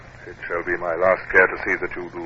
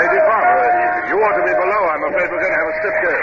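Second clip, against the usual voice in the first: calling over wind; 9 semitones higher, 5 dB louder, faster than usual. Acted. Captain calling over the storm.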